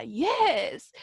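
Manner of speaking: ordinary talk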